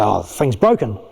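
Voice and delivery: low, resigned aside; deflated and dropping low; giving up. Acted. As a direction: give up on it with a sigh of a line. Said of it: "Eventually you might go".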